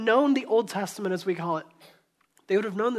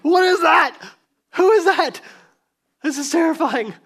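loud, high panicked cries; frightened shout; 7 semitones higher, 11 dB louder than usual. Retold or imitated. Disciples in the boat shout in panic at the figure. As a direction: yell out in mock terror.

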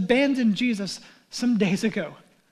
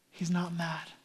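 softer, almost hushed "He's not mad"; soft reassurance; 5 semitones lower, 10 dB quieter than usual. Acted. Angel telling frightened disciples "He's not mad", hushed. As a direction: lean in and say "not" gently.

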